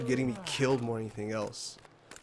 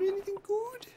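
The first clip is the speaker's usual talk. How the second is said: squeaky high voice. Pretend. Repeats the question, playful voices.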